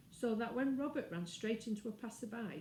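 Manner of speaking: usual speaking voice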